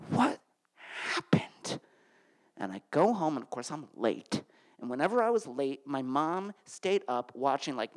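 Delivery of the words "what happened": bewildered whisper. Retold or imitated. Confused after she leaves.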